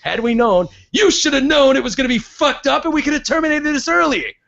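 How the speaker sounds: high, ranting mock rich old man; Don Sterling voice. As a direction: rant like a selfish old tycoon. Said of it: "This is Don Sterling giving this interview".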